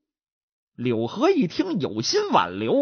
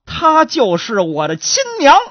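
higher, louder revelation; wife confesses the truth to her husband; blurted confession; 7 semitones higher, 6 dB louder than usual. Acted. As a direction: finally blurt out the secret. Wife reveals her mother.

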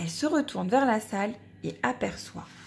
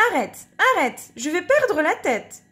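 louder, higher exasperated mother; mother at wit's end, raised voice; mother storming; 6 semitones higher, 4 dB louder than usual. Acted. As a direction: scold like a frazzled mum. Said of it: "Sa mère tempête".

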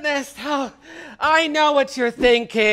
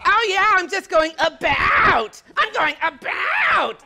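"going about" in a hammed-up American accent with a flat, drawn "about"; mock American accent; 3 semitones higher than usual. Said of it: "She just sounds like some sort of".

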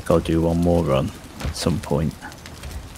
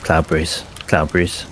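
ordinary delivery

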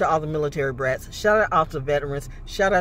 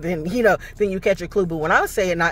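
normal talking voice

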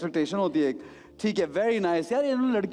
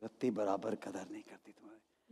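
low, much softer repeat; hushed echo; 11 semitones lower, 14 dB quieter than usual. Retold or imitated. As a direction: repeat the line quietly to yourself. Host murmurs back her line. (धीमी, दबी आवाज़ में दोहराना)

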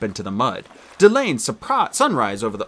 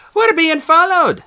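loud, much higher and slow, buzzing with thrill; excited; 7 dB louder, slower than usual. Acted. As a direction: blurt it out thrilled to the core. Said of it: "he said excitedly".

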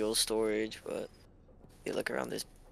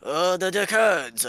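put-on French accent; 6 semitones higher, 7 dB louder, slower than usual. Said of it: "Can you do a French accent".

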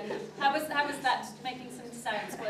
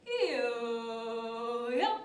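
hanging wobble yo-yo; 2 semitones lower than usual. Shown as a demonstration. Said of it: "hangs for a bit, wobbles".

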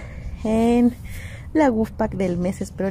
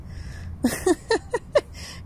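breathy giggle; 10 semitones higher, 4 dB quieter than usual. Real, not acted. Reacting to the box.

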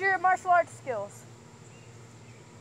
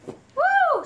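high loud whoop; excited whoop; 7 dB louder than usual. Real, not acted. As the dog jumps on her, a loud excited whoop. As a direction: let out a sudden squeal of excitement.

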